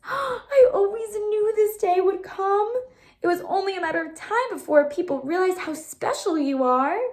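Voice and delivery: high, choked-up happy crying; overjoyed mother fighting back tears; teary joy. A narrator's reading. Mom flushed with happiness, teary.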